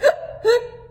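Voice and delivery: sharp high gasping hiccup intake. Acted. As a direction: gasp in like a hiccup. Hiccup gasp.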